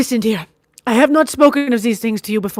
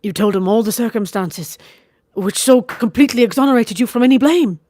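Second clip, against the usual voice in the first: higher, louder bitter scorn; vehement bitterness; 4 semitones higher, 6 dB louder than usual. A narrator's reading. Wife bitterly confessing her marriage, vehement scorn. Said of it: "She spoke with vehement bitterness".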